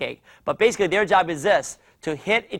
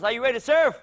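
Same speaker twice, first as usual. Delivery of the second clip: lively, higher-pitched question to the group; rousing call; 6 semitones higher, faster than usual. Real, not acted. Coach to boys and girls before surfing, rousing call. Rousing kids, first take.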